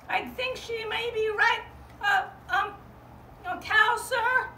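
high, excited lamb voice; a small eager lamb looking for family; little sheep. Acted. Little sheep searching for her cousin, high and eager.

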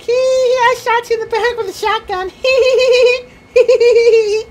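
very high, squealing giggly voice; tiny gloating voice with hee-hee laughs; mocking camper impression. Gamer mocks a corner-camping shotgunner, squeaky gloating voice. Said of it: "There's a guy just sitting in the corner like".

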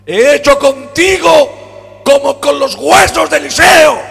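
loud, high, impassioned sermon delivery; fervent Pentecostal preacher in full cry; full-force preaching. Acted. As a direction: preach it out, voice climbing. Preaching with rising fervour.

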